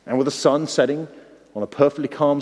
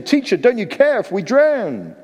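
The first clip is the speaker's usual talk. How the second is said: panicked plea; 7 semitones higher than usual. Acted. Disciples' desperate plea.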